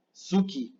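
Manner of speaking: suki as two syllables, "su-ki"; fully sounded U. Shown as a demonstration. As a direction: voice the U in suki clearly. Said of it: "mal, no es suki".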